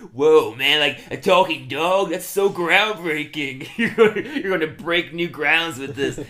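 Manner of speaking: slow, sneering sarcasm with a laugh; drawn-out, mocking disbelief; mock sarcastic. Friend retelling a cartoon scene, slow sarcastic sneer.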